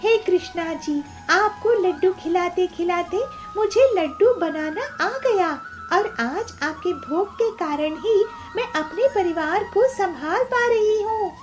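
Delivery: high-pitched, joyful prayer; devout young seller, thankful. Acted. Peelu thanking Krishna for her livelihood, high devout joy.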